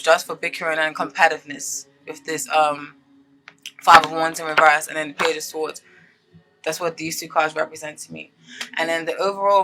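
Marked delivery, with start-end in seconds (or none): none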